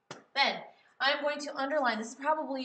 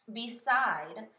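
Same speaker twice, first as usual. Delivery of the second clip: Beside as be-SIDE, louder on side; stress on second syllable; 3 semitones higher, 6 dB louder than usual. Shown as a demonstration. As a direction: push the end of Beside. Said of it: "It should be the second syllable".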